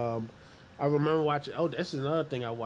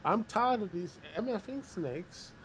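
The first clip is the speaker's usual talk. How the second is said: mock Samuel Jackson; 8 semitones higher than usual. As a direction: growl the snake line like Samuel Jackson. Samuel Jackson quote acted out.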